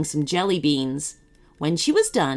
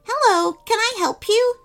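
very high, eager greeting; little gingerbread boy playing shop assistant; chirpy shopkeeper; 3 dB louder than usual. A narrator's reading. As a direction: pipe up helpfully.

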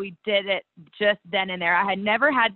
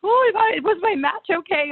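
higher, slightly pleading voice; worried retold question; 9 semitones higher than usual. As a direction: imitate her dazed worry, pitched up. Retold injured wrestler asking.

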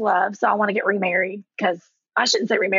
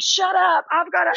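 higher-pitched mock impatience; retold exasperated brother; 8 semitones higher than usual. Brother silences his teasing sister, mock-exasperated. Acts out brother cutting her off.

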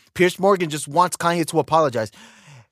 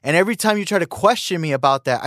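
ordinary delivery

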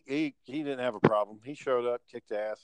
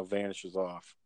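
usual speaking voice

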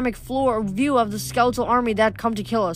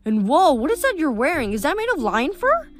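put-on teen girl; 4 semitones higher than usual. Narrator voices Rachel.

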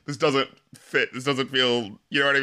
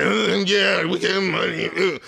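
hungover, just-woke-up mumble; mock groggy, slurred rapping; slower than usual. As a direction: slur it like waking after a bender. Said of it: "sounding like he straight up just woke up after, like, a bender".

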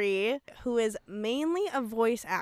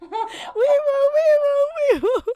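high slow wailing siren; siren imitation; 9 dB louder than usual. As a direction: wail it like a police siren. Mock siren again.

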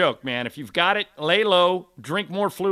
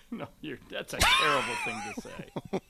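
shocked laugh; 5 dB quieter than usual. Real, not acted. Reacting to [name] joke.

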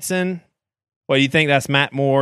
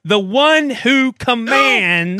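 building to a preacher shout; preaching; 6 semitones higher than usual. Pretend. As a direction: revival preacher voice, rising.

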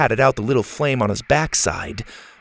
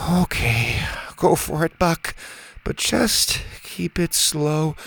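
nervous; 4 semitones higher, 4 dB quieter, slower than usual. Acted. Bo nervously instructs Buck.

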